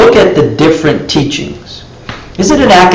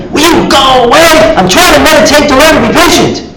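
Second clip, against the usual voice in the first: high, exasperated plea to be left alone; mock angry meditator; 12 semitones higher than usual. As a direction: lose patience, mildly hammed-up. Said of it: "he gets all angry".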